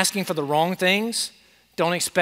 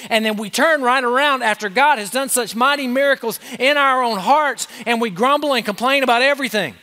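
building pulpit fervour, higher and louder; rising preaching; 7 semitones higher, 3 dB louder than usual. Acted. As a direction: drive the rebuke home from the pulpit. Preaching builds to full force.

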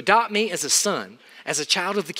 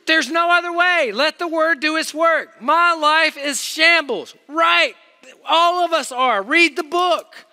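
loud, high, hammering sermon delivery; full-force preaching; 10 semitones higher, 6 dB louder than usual. Acted. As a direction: pound each line home to the students.

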